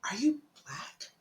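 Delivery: soft, whispered retold question; hushed question. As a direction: imitate him asking in a slow whisper. Retelling his hesitant, intrusive question.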